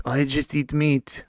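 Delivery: slow, put-on voice for "I just eat meat"; mock the coworker's simple boast, slowly; imitating the coworker. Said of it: "That's what he told me".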